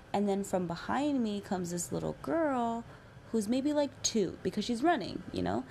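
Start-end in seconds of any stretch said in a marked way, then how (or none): none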